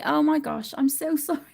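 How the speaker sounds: usual speaking voice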